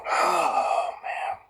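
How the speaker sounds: a real catch in the throat on a drawn-out oh man; breaking off, close to crying; choked-up sigh. Real, not acted. Vlogger chokes up mid-rant, close to tears. Choking up after angry rant.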